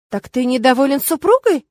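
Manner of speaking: offended, dangerous questioning; strange voice. A narrator's reading. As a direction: ask it oddly, as a challenge. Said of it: "странным голосом спросила мама".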